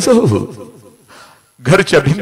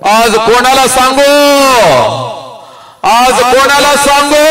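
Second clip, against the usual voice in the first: high, loud preacher's wail; anguished refrain; 9 semitones higher, 3 dB louder than usual. Acted. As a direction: cry the question upward. Repeated lament refrain. (उंच स्वरात विलाप)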